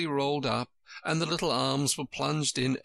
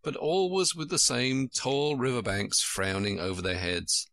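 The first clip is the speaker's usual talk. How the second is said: ordinary delivery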